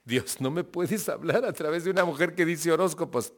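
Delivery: a mock-exasperated plea to heaven; incredulous plea to God. Speaker retelling his own baffled plea to God, incredulous. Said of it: "y me impresionó tanto que dije".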